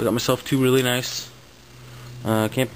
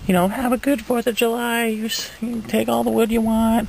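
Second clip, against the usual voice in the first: much higher mock voice of the woman selling; imitating the seller lady; 12 semitones higher, 3 dB louder than usual. Retelling the seller woman's offer in a high mock voice. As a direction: play the older lady, pitched way up.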